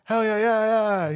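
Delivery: a high, drawn-out hell yeah, hammed up; retelling a TikTok guy cheering as the car starts; mock cheer. Retelling the TikTok guy's cheer when the car starts, hammed-up high cheer.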